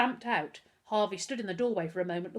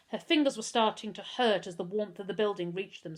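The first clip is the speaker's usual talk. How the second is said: ordinary delivery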